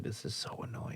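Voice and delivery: breathy muttered whisper; whispered aside. Retold or imitated. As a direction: whisper it under your breath. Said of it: "I'd be like".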